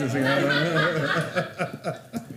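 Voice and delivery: loud, high burst of laughter; real hearty laugh. Real, not acted. Board joking at meeting's end.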